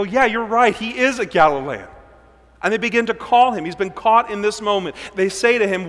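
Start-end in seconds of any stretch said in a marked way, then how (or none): 0.0-1.9 higher, louder eager agreement; bystanders agreeing. Acted. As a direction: jump on the accusation. Crowd agrees, accusing.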